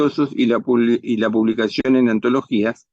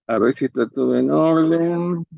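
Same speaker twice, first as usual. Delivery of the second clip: revisando documentos; 5 semitones higher than usual. Acted. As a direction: trail the last word out while inspecting. Empleado revisa el pasaporte. (revisando, alargando el final)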